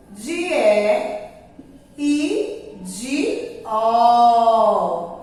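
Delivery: slow, stretched teacher demo of the vowel sounds; drawn-out vowel demo. Shown as a demonstration. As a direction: stretch each vowel slowly for the class. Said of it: "quando estão na sílaba forte". (pronúncia lenta e alongada das vogais)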